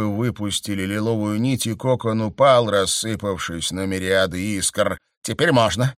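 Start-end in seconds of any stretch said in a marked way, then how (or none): 5.2-5.9 curt. A narrator's reading. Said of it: "бросила незнакомка".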